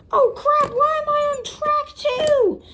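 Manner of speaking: high, frantic yell; panicked yelling. Acted. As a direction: squeal in panic. Character on wrong track.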